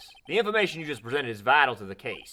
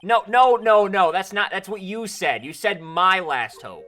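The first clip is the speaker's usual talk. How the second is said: shouting; 7 semitones higher, 11 dB louder than usual. Acted. Accusing witness in court.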